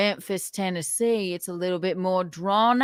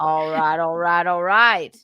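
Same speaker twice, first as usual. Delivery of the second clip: Matthew McConaughey; 3 semitones lower, 3 dB louder than usual. Acted. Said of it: "Matthew McConaughey".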